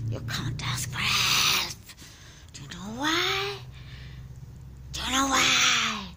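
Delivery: deep, loud demonic shout. Acted. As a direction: roar it down at the victim. Demon denying the plea.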